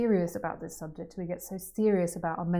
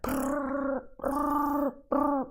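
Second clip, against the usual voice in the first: mind chatter noise; 8 semitones higher, much slower than usual. Retold or imitated.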